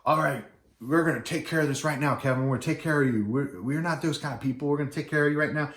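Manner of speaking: a hint of a take-charge grandfather; grandfather taking charge. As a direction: imitate a nice grandfather firmly reassuring someone. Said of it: "the grandfather of the bride came over".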